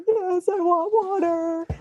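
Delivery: high, slow, plaintive voice, retold; a weak runner begging for water; pitiful plea. To the little girl offering water, a high slow pitiful plea.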